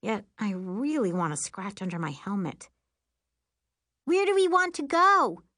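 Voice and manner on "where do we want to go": high, loud call over wind; shouting. A narrator's reading. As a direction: call back to your passenger from the front. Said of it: "Miri shouts from the front".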